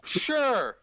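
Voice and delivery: ordinary talk, nothing marked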